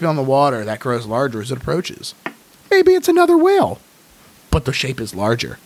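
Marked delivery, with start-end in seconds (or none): 2.7-3.8 high wondering voice. Acted. Whale possibility, high voice.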